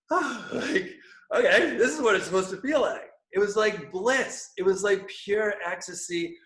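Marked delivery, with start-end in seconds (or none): 0.1-3.1 blissful relief. Retold or imitated.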